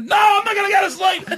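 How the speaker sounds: very high mock-toddler shriek; toddler's defiant yell. Toddler refusing dad in the car, shrieked defiance. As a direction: imitate a small boy refusing point-blank.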